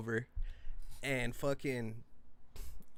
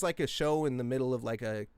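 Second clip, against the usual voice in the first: usual speaking voice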